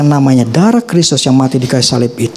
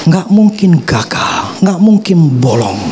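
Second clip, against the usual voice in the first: slow emphatic; slower than usual. Real, not acted.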